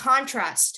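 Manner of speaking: "contrast" as CON-trast; strong start on "contrast", falling away; stress on first syllable. Shown as a demonstration. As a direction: noun "contrast", front-stressed. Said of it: "So if you were given a noun".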